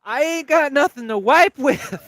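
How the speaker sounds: high, loud comic holler; retold yell. Friend squatting in a field calls to the others, yelling.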